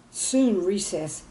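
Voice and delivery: ordinary delivery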